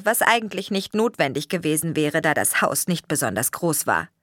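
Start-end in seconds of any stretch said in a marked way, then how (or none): none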